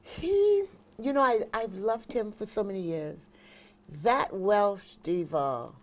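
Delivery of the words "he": drawn-out, much higher squeal of delight; slow high swooning gasp of admiration; gushing exclamation. Real, not acted. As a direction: swoon over the star, stretching every word. Gushing over [name].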